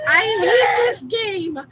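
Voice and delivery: high, slow wail through laughter; laughing while crying out. Retold or imitated. Retelling friend laughing so hard she cries at a game, high wailing laugh. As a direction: cry it out while laughing helplessly. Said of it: "she was like".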